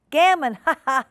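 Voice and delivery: loud, high jeering cackle; mocking shout. Doctor Maggotty jeers loudly at Duchess peeping round the corner. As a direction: jeer at the peeping cat. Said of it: "he shouted".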